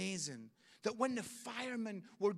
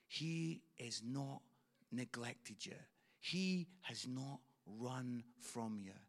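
tender reassurance; 7 semitones lower, 4 dB quieter than usual. Real, not acted. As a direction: lean in gently and reassure. Pastor speaking tenderly of God.

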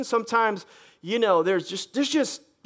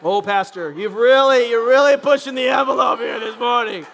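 loud mock protest of a churchgoer; indignant congregant; 5 dB louder than usual. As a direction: complain like a member losing his seat.

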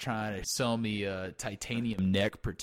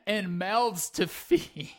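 much higher, slow, pathetic plea; mock pleading; 10 semitones higher, 6 dB louder, slower than usual. Host begging the guest for his sex doll family, slow pathetic plea. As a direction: drag it out, begging with a straight face.

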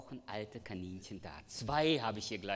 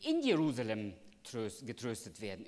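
in said louder, as the new reading; stress on in; 5 dB louder than usual. Shown as a demonstration. Preacher showing the congregation a better translation, stressing in. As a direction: lean on the word in, not an. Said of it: "diese Präposition hier auch mit".

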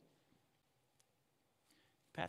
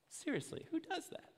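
much higher and incredulous; exaggerated who does that, pitched way up; outraged disbelief; 3 dB quieter than usual. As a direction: pile on the shock, voice climbing high. Repeated with mock outrage.

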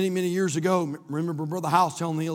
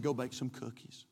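much lower, softer, understated; calm deadpan advice; 6 semitones lower, 13 dB quieter than usual. Retold or imitated. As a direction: play the unruffled pastor, dropping it quietly. Pastor's calm advice in story.

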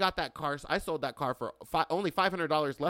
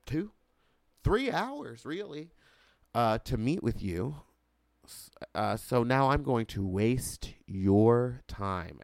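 halting, drawn-out mock patience; quiet and slow; slower than usual. Customer wasting the finance guy's time, quiet and slow. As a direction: stretch the words to waste their time. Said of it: "start talking very quietly and very slowly".

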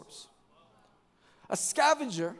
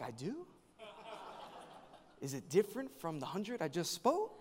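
bewildered; 10 dB quieter than usual. Retold or imitated. Pastor answers insistent stranger, soft puzzled deadpan. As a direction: play the underwhelmed, confused preacher quietly. His baffled reply to admirer.

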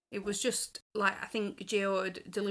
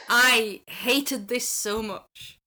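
slow, laughing through every word, louder; 8 dB louder, much slower than usual. Real, not acted. Host laughing as she pans the rumba. Laughing while condemning the dance.

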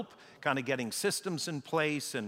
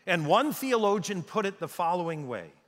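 ordinary talk

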